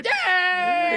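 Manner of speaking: high, loud holler; rowdy whoop. Retold or imitated. Latecomer drowns out a praying elder, high rowdy whoop. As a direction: burst in whooping over everyone. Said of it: "come in and just drown him out".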